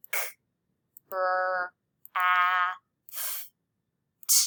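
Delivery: "K, R, A, F, T" as bare sounds, long pauses between; phoneme by phoneme. Shown as a demonstration. Teacher segmenting craft for students, sound by sound. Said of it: "Craft has five phonemes".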